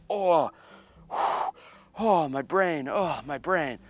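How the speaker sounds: high pained groan, imitating; mock agony. Cameraman reels after snorting powder, hammed-up agony. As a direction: act out a searing head rush.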